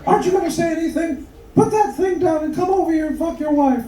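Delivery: very high falsetto wife; impatient wife. Acted. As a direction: nag in a mock woman's falsetto. Voices impatient wife in joke.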